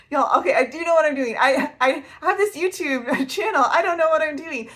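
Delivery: loud, high, laughing through the words; flustered, laughing self-mockery; laughing protest. Real, not acted. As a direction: protest while cracking up at yourself. Laughing at her own confession.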